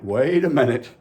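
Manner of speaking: building mock alarm. Repeating the exclamation louder.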